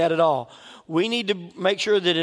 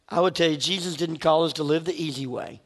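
ordinary talk, nothing marked

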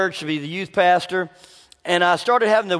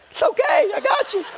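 mock reassurance. A young pastor brushing off worried parents, hammed-up and high. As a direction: play the cocky young pastor soothing parents.

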